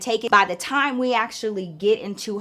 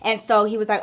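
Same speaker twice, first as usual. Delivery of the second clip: ordinary delivery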